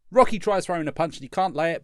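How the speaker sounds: ordinary delivery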